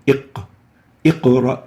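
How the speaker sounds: "iq" bounced on the q, then whole "iqra"; "iq, iqra" with a strong vibrating q; qalqala demonstration. Shown as a demonstration. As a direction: release the q in "iq" and "iqra" with a short echo. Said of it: "pronounce it strong with vibration".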